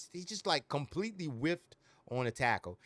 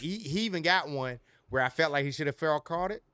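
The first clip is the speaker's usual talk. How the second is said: ordinary talk, nothing marked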